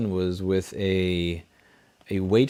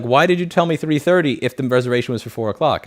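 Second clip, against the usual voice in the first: raised, indignant questioning; retold annoyance; 4 semitones higher, 5 dB louder than usual. Date confronts him over three thirty, retold annoyance. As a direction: imitate an annoyed demand, louder and higher. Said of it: "she was actually pissed".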